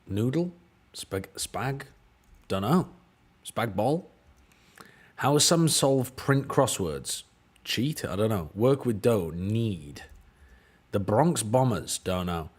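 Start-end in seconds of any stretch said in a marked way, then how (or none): none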